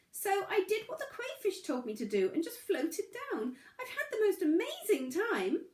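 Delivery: a tiny stone, a bit flustered; small pebble voice. A narrator's reading. Pebble tells its story.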